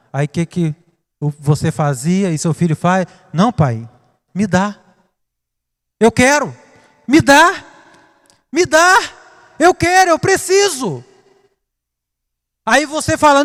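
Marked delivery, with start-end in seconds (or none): 3.3-11.0 put-on whining child. A child whining at his father for a gift, building up. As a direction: beg like a spoiled kid who won't give up.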